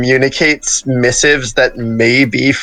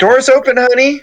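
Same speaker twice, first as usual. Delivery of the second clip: calling out; 11 semitones higher than usual. Acted. Calling out to a stranger.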